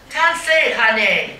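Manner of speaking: loud, high playful "honey"; mock flirty call. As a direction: call to a guy across the street, hammed-up. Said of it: "I should try that stunt".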